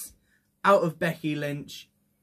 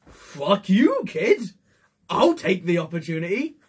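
defiant; 2 semitones higher, 4 dB louder than usual. Acted. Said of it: "Bayley's just like".

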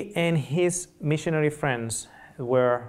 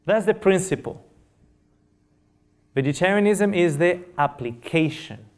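raised, emphatic sermon voice; preaching; 4 semitones higher, 4 dB louder than usual. Acted. Preacher driving home the principle of vegetarianism, emphatic. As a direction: proclaim it to the congregation.

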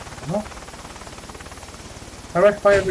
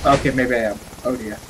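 ordinary delivery

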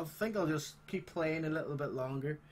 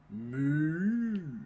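mooing like a cow; loud moo; 5 dB louder than usual. Pretend. Player greeting a cow, loud mock moo. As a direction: moo loudly at it.

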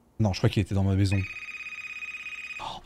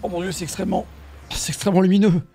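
high, startled exclamation; scared horror game streamer; 5 semitones higher, faster than usual. Real, not acted. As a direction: gasp it out, spooked. Streamer reacting to bright scene.

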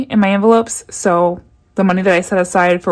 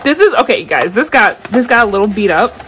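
ordinary delivery

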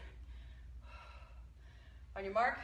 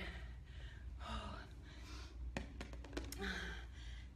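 relieved sigh; 4 semitones lower, 12 dB quieter than usual. Real, not acted. Workout finished.

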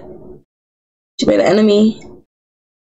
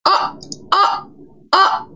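high breathy gasps; 12 semitones higher, 4 dB quieter, slower than usual. Acted. Reacting to a Sim's taunt.

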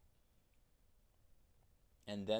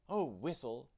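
higher, louder cry; cried out; 4 semitones higher, 5 dB louder than usual. A narrator's reading. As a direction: call out in dismay. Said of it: "cried Tootle".

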